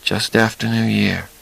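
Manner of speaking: soft and quiet. A narrator's reading. Charlie continues softly.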